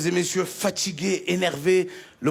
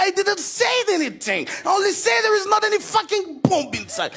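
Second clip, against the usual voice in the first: higher angry English protest; annoyed; 9 semitones higher than usual. Retold or imitated. Comedian argues with officer, higher exasperated English. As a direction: push back in exasperation. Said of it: "j'ai été énervé".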